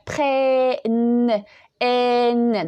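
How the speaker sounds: PRENNENT drawn out with an open E and a long N. Shown as a demonstration. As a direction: stretch PRENNENT on an open è, holding the final n. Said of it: "on prononce un E ouvert".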